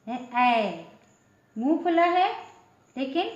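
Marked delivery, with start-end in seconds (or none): none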